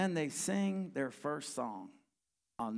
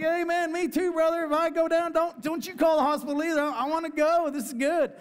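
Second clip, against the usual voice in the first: loud and much higher, swept up in joy; fervent shout; 13 semitones higher, 9 dB louder than usual. Retold or imitated. Rob answering the old veteran under the tent, a high joyful shout. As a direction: cry it out like you're ready to go home to God.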